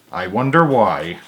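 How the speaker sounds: ordinary delivery, nothing marked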